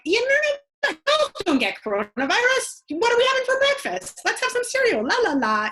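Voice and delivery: high, loud singsong brush-off; chirpy mock-carefree parent dodging the topic; breezy avoidance. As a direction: sweep the worry away with fake cheer. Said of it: "to just be like".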